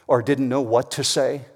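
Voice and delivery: ordinary delivery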